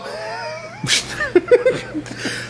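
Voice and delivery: high shrieking laughter. Real, not acted. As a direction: burst into a squealing laugh. Scare game in the dark.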